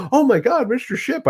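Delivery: acted high-pitched fluster; flustered greeting. Husband running over to a slighted star guest, flustered. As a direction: exclaim it high, as if rushing over.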